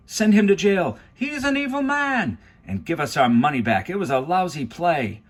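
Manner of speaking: loud, high jeering cries; crowd shouting. A narrator's reading. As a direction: yell from the angry audience. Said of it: "other people took up the cry".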